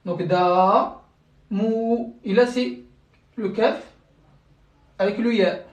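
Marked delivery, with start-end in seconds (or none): 0.2-1.0 "da" with a long, drawn-out aa; long vowel. Shown as a demonstration. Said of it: "prolongation de deux temps". 1.5-2.1 "mou" with a long, drawn-out oo; long vowel. Shown as a demonstration. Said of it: "prolongation de deux temps".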